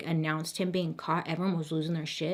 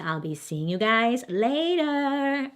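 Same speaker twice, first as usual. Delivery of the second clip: sing-song sign-off; 5 semitones higher, slower than usual. Real, not acted. Signing off the video.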